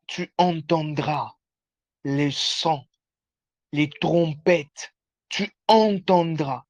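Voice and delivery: slow, solemn prophetic proclamation; prophetic declaration. Acted. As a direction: declare each promise slowly. Prophetic declaration over listener. (déclaration prophétique lente)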